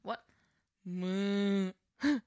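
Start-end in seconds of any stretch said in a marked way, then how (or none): 0.8-1.7 cow moo. Pretend. Asked for a cow, she moos. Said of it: "I'll do an impression".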